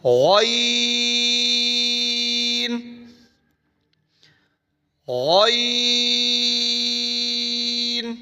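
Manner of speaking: غین thick, with the vowel held very long; long heavy ghayn. Shown as a demonstration. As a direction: stretch غین slowly and thick. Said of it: "لمبا پڑھنا ہے".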